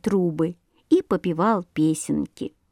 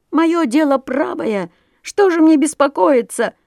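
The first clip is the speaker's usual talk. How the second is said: higher, louder defiant cry; indignant yelling; 6 semitones higher, 3 dB louder than usual. A narrator's reading. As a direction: shout back defiantly. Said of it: "орал Воробей Воробеич".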